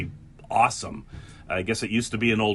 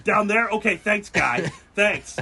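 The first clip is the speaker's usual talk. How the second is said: high, loud overdone thanks; mock gratitude; 10 semitones higher, 4 dB louder than usual. Driver thanking the attendant with loud mock gratitude. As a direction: gush with fake relief.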